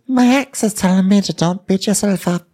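high, far-off put-on voice; imitating Tasha talking to camera; mock Tasha. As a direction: gush it like a silly reality star. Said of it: "then she tells us".

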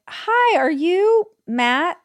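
high, slow, eager hello to a stranger; bright greeting. Retold or imitated. Retelling meeting the wallet's owner, high eager greeting. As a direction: sing out the name like a happy surprise. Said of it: "And I was like".

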